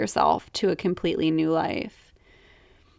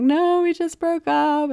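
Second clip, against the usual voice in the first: recalling the reply through tears, pitched higher; reliving the tearful answer to her brother, a lighter retelling; retold tearful reply; 10 semitones higher than usual. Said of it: "And I'm like".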